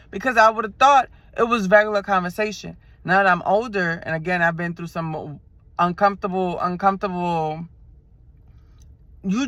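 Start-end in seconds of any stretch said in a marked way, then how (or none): none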